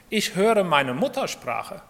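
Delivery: normal talking voice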